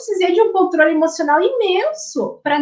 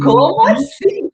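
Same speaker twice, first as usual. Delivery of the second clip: higher, louder, slower, drawn out; mock indignant kid; 4 semitones higher, 4 dB louder, slower than usual. Child answering back to the parent, mock indignant. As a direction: stretch it like an offended teen. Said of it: "o filho vai falar".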